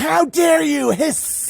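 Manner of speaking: high, drawn-out indignant imitation; mock outrage. Imitating the outraged reply to a doubter, mock outrage. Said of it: "It's like".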